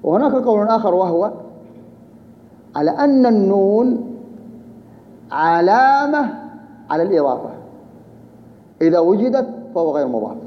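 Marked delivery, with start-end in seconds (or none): 2.8-6.3 "النون" and "علامة" drawn out long and high; emphatic teaching point. Real, not acted. Teacher presenting another opinion on the noon, emphatic and drawn out. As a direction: stretch "علامة" slowly at a raised pitch. Teacher underlines the alternative view.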